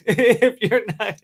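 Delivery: real laugh bubbling through the words. Real, not acted. Explaining anti-fascism to listeners, cracking up laughing.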